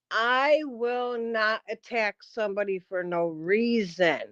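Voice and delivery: slow, deliberate, word by word. Shown as a demonstration. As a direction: spell it out for slow listeners. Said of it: "let me say it a little slower".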